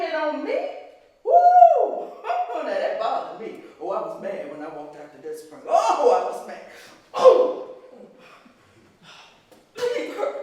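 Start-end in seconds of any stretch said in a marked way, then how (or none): 1.2-2.1 high angry whoop. Real, not acted. Outburst of anger. 7.1-10.3 angry cry. Acted. Angry outburst.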